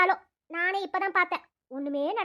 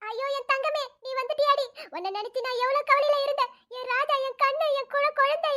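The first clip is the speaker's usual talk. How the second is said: higher, trembling tearful cooing over a grandchild; tearful joy; 5 semitones higher than usual. Acted. Grandmother seeing grandson again, crying with joy. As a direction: weep with relief while doting on the baby. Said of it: "இதுக்கப்புறம் ஏன் அழுவுற?".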